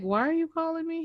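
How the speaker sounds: high, suspicious demand; mock indignant question. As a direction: challenge the caller, puzzled. Retelling scam call.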